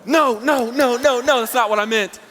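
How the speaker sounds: high, loud flustered backpedal; panicked denial. Retold or imitated. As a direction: scramble to take it back. Panicked correction of a gaffe.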